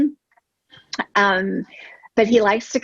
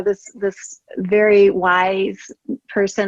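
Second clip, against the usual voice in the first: normal talking voice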